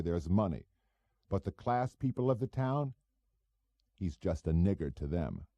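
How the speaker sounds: a hint of a cocky small-time operator. Goebel voiced in dialogue.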